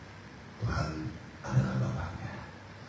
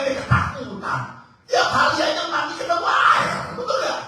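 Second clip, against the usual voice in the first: mock scolding; 14 semitones higher than usual. Preacher voices Jesus scolding disciples.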